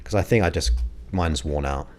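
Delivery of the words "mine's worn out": usual speaking voice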